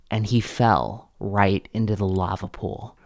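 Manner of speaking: plain reading voice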